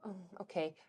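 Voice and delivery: ordinary talk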